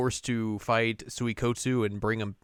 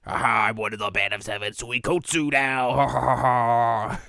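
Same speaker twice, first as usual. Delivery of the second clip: mock villain boast; 5 dB louder than usual. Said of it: "He's like".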